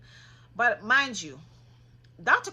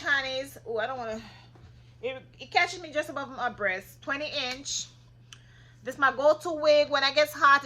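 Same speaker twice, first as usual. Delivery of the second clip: ordinary delivery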